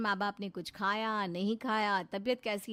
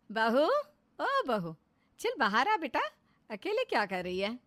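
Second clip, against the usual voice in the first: calling loudly toward a closed door; mother-in-law calling her daughter-in-law, loud and coaxing; 4 semitones higher, 6 dB louder than usual. Acted. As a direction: coax her out of the room with a raised voice.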